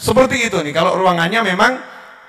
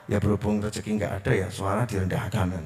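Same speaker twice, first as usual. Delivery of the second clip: much softer, lower, hurried limp prayer; mock timid prayer; 11 semitones lower, 11 dB quieter than usual. Shown as a demonstration. Preacher mocking a timid prayer, soft and low. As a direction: mutter it like you've already given up.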